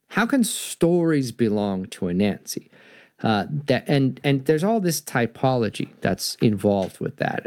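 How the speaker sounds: plain reading voice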